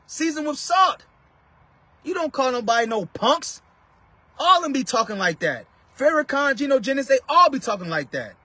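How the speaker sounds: high-pitched preacher's rebuke; impassioned preaching. Real, not acted. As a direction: preach it up high, hitting phrases hard.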